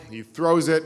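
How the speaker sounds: ordinary talk, nothing marked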